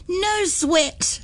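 put-on high, soft child voice; a cheeky little girl's reply; Nettie. Nettie answering her aunt in a high, soft child voice.

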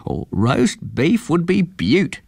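put-on a slow, happy old eagle; delighted eagle-man. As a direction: savour the thought of beef. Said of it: "said the eagle".

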